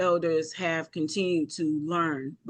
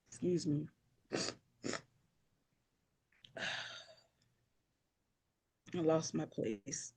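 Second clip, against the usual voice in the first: choked up; 12 dB quieter than usual. Real, not acted. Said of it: "I apologize for getting so emotional".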